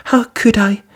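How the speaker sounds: put-on high, slow, sad girl's voice; Cinderella's voice. A narrator's reading. Cinderella sadly asking her stepsister how she could go. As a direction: let her sound small and forlorn.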